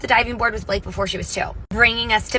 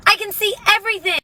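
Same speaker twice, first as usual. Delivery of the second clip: put-on much higher, louder amazed child; amazed kid voice; 11 semitones higher, 4 dB louder, faster than usual. Daughter seeing underwater for the first time, high amazed squeal. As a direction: squeal the discovery like a little girl.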